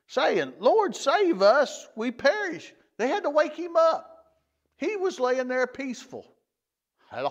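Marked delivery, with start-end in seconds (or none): 0.6-2.7 higher and louder, slow desperate cry for help; retelling the disciples' panicked cry; frightened plea. Retold or imitated. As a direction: beg the Lord to save you from drowning. Disciples waking Jesus in storm.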